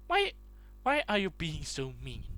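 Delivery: ordinary delivery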